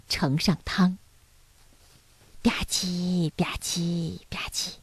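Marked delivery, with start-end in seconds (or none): 2.4-4.7 soft, hushed slurping noises; lip-smacking imitation. A narrator's reading. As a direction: make quiet little eating sounds. Narrator imitates everyone eating soup. (小声模仿吧唧嘴)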